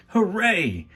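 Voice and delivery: high, loud cheer from the crowd; joyful cry. A narrator's reading. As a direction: shout it out with delight. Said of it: "cried a voice from the audience".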